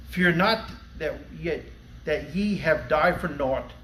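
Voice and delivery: ordinary talk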